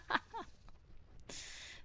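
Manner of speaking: high, soft giggle; soft amused laugh; real laugh. Real, not acted. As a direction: giggle quietly in a long burst. Streamer laughs at a remark.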